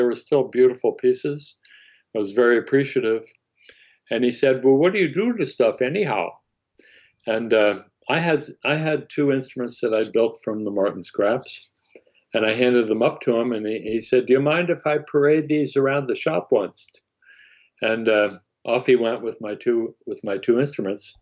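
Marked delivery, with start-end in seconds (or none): 4.6-6.3 higher, swooping Pennsylvania Dutch lilt; Pennsylvania Dutch accent. Retold or imitated. Foreman quizzing the scrap collector, in a swooping Pennsylvania Dutch accent. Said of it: "accent, he said". 14.3-16.9 higher-pitched, lightly imitated Pennsylvania Dutch accent. Foreman asking to show off the instruments, lightly Pennsylvania Dutch. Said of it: "accent, he said".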